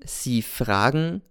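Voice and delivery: gedehnt und bedeutungsvoll; „Sie fragen“ langsam und lauernd ziehen. Acted. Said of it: "sagt dann langsam". (Cäsar bleibt stehen und spricht langsam, sonderbar)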